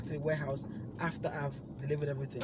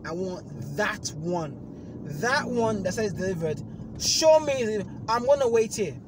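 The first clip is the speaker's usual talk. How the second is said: angry demand; 6 semitones higher, 8 dB louder than usual. Retold or imitated. Demanding the delivered item from the agent, angry. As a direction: dig in and demand.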